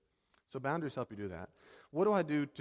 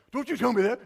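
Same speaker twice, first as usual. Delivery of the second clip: sharp, much higher and louder comeback; indignant outburst; 11 semitones higher, 11 dB louder than usual. Pretend. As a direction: snap back at the offender. Acted hypersensitive snapping back.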